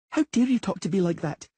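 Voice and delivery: high, indignant little princess; girl scolding the man, haughty and high. A narrator's reading.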